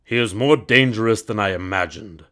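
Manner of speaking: loud, menacing emperor voice; a ruler uneasy but commanding; emperor muttering. A narrator's reading. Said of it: "muttered the splendid emperor".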